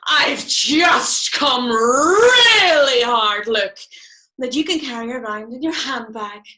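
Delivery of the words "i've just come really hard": slow, loud, swelling cry on a drawn-out REALLY; orgasmic exclamation. Acted. Naming a blusher's flush to friends, mock orgasmic. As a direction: belt it out slowly, stretching and swooping on REALLY.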